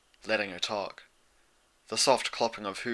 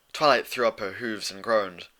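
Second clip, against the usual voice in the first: usual speaking voice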